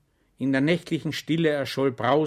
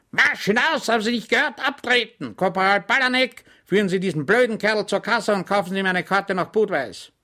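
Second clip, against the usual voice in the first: much higher, louder bellow; 9 semitones higher, 6 dB louder than usual. Acted. The lieutenant bellows at Schwejk and corporal. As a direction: roar him out of the room. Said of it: "brüllte der Leutnant".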